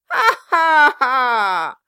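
high loud mocking cackle; gloating cackle. Quilp laughs at Kit, gloating cackle. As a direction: cackle with glee.

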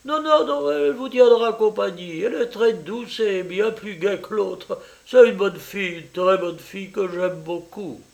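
eager landlady mother. Acted. Mother welcoming her daughter's French lover, eager and high.

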